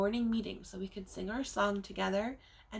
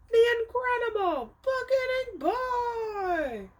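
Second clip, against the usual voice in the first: high, slow, ringing title call; big storybook fanfare, drawn out high; grand announcement; 12 semitones higher, 5 dB louder, slower than usual. Acted. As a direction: proclaim the title like a showman. Title proclaimed with flourish.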